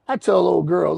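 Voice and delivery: ordinary talk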